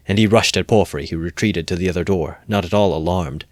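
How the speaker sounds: usual speaking voice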